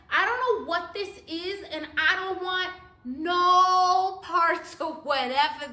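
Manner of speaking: high, drawn-out refusal; acted protest. Acts defiant reply in the car.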